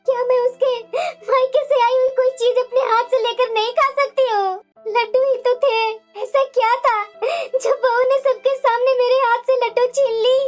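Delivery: wailing grievance between sobs; tearful complaint. Acted. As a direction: cry as you complain to your husband. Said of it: "तुम्हारी आंखों में आंसू क्यों है". (रोते-रोते शिकायत करते हुए)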